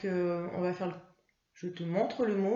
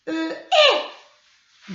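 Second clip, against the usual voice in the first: put-on much higher, louder child puppet voice; Mimi; 7 semitones higher, 11 dB louder than usual. Teacher voicing Mimi the puppet, high loud child voice. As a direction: pipe up like a little puppet.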